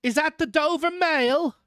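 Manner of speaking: high-pitched distant hail; calling through mist. A narrator's reading. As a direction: call out a question from afar. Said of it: "a man's voice called through from the mist".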